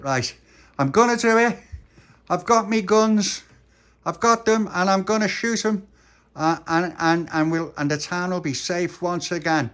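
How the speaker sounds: squeaky cowboy bravado; high boastful character. Acted. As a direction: brag like a tiny gunslinger. Cowboy hero brags.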